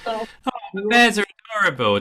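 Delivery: high, quick and bright with glee; gushing delight. Real, not acted. Host gushing to the group about bear footage, excited. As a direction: coo over the cute bears, fast and high.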